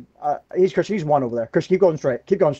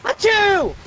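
high-pitched exaggerated sneeze; mock sneeze; 3 dB louder than usual. Player mimicking a sneeze in game banter, high mock sneeze. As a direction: burst out a squeaky achoo.

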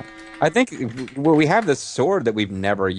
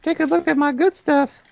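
very high squeaky merchant; high character voice. Pretend. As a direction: squeak like a cartoon trader.

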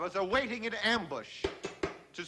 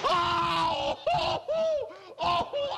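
pained yelp; 8 semitones higher, much slower than usual. Acted. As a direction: yelp after the hammer blow. Hammer mishap, cries out.

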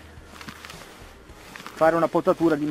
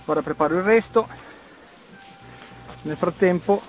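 out of breath from digging; panting lightly between words; dopo uno sforzo; 5 semitones higher than usual. Real, not acted. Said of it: "scusate il fiatone". (con il fiatone)

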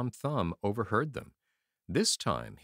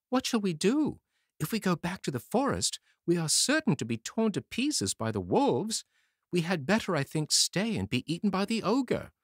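put-on pleading boy; 9 semitones higher than usual. A narrator's reading. Tom Thumb pleading with the ogre's wife, high and urgent. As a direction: beg the lady urgently.

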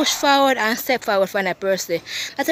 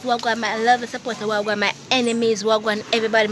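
ordinary delivery

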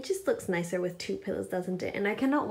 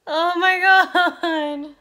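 slow, high, loud delight; 8 semitones higher, 10 dB louder, much slower than usual. Real, not acted. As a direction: stretch it out, thrilled. Delight at comfy mattress.

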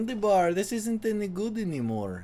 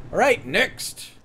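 mock Eastern European accent; 4 semitones higher, slower than usual. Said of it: "going in and out of the accent".

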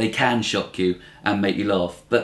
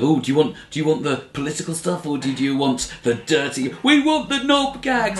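hammed-up club comic, the last line shooting up high; cheesy comic; 4 semitones higher than usual. Mocking comics who offer the crowd a choice, cheesy tease. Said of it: "comics do that old thing of".

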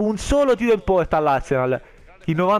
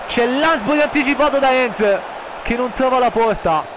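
rising, louder play-by-play; excited commentary; 8 semitones higher, 4 dB louder than usual. Acted. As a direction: call the save with excitement.

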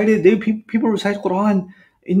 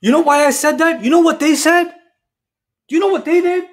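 arguing; 9 semitones higher, 4 dB louder than usual. Acted. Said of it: "Look, two people are arguing".

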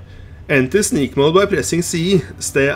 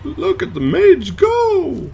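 high, slow, showy cheer; hammed-up excitement; 14 semitones higher, much slower than usual. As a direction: echo it with exaggerated glee. Echoes friend, hammed up.

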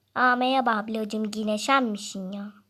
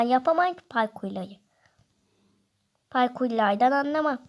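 normal talking voice

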